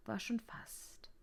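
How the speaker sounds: very soft, slow, whispery; sleepy whisper. A narrator's reading. Narrator as the guest dozes off, sleepily whispering. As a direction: drift off slowly into a whisper.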